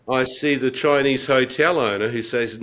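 ordinary talk, nothing marked

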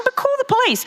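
urgent plea to call police. Real, not acted. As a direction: plead it high and urgent. Urging action, pitch leaps.

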